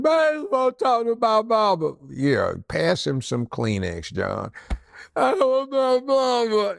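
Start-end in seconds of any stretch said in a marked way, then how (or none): 0.0-1.9 put-on high, tearful pleading like a scared kid; crying for mama. Suspect begs the cops for his mama, high and tearful. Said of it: "And he's crying". 5.2-6.8 put-on high, tearful pleading like a scared kid; crying for mama. Suspect begs the cops for his mama, high and tearful. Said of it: "And he's crying".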